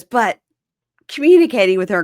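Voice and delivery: loud, pressing argument; loud insistent. Retold or imitated. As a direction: push it loudly. Quoting drunk boyfriend.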